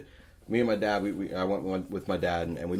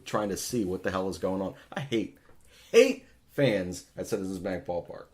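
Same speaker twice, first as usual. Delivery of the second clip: ordinary talk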